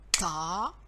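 "Ta-ba" split in two, long first syllable, clear pause; découpé en syllabes. Shown as a demonstration. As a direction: say "Ta-ba" one syllable at a time, slowly. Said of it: "se coupe en deux syllabes". (« Ta-ba » détaché en deux syllabes)

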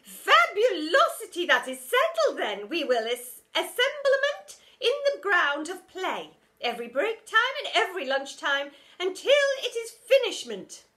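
high, loud grand decree; pompous teacher. A narrator's reading. Teacher decreeing work to the kids, loud and grand. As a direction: settle it grandly.

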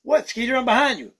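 ordinary talk, nothing marked

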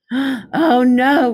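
a loud groan of dismay. A narrator's reading. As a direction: groan it like the shamrocks are lost. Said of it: "Rabbit groaned".

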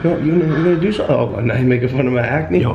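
usual speaking voice

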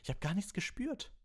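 very high, excited exclamation; Harry, excited. A narrator's reading. Harry assuring Professor Lupin he felt nothing, excited. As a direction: blurt it high, full of excitement. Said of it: "sagte Harry aufgeregt".

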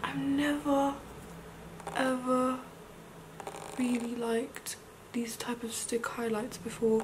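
soft, halting and awed; hushed confession. Real, not acted. Vlogger confessing new love of highlights, hushed. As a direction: say it softly, word by word.

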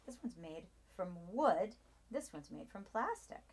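usual speaking voice

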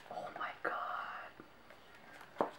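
slow, hushed, breathy oh my god; awed whisper. Real, not acted. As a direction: barely breathe it out.

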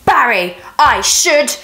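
queen bee yelling crossly at Barry. Acted. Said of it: "Then the Queen yelled crossly".

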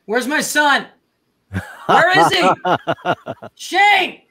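ordinary talk, nothing marked

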